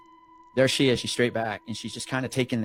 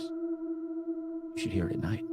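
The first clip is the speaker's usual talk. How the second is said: put-on eerie kid voice; 8 dB quieter, much slower than usual. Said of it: "He goes".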